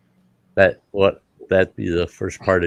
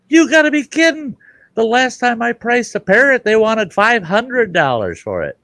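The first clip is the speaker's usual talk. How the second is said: high, loud incredulous outburst; loud disbelief; 6 dB louder than usual. Acted. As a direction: exclaim it as if the price is absurd. Buyer acted in disbelief at price.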